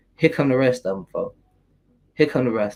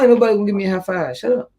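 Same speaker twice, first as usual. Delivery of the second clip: dismissive retort; 5 semitones higher, faster than usual. Retold or imitated. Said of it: "I'm not".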